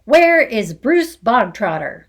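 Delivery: loud barked question; barking demand. Acted. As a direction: demand it like a drill sergeant. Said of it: "the Trunchbull barked suddenly".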